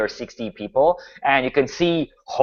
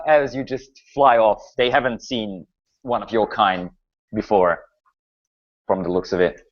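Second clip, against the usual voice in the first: ordinary talk, nothing marked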